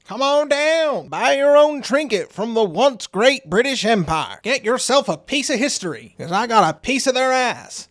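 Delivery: high, hammed-up salesman's pitch; exaggerated advert barker, pitched high; mock huckster. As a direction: sing out the bargain like a showy hawker. Mock sales pitch for empire trinkets.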